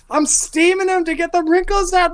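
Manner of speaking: very high screeching falsetto; indignant laundry woman. Acted. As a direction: screech at whoever is wrecking your washing. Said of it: "And is just like".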